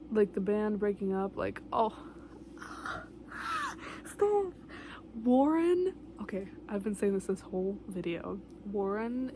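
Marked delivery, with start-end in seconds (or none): 2.0-4.0 sudden high-pitched gasp; sharp high gasp. Real, not acted. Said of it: "sudden gasp at band breakup". 4.1-5.9 high, loud excited call; excited shout. Real, not acted. Excited outburst about favorite.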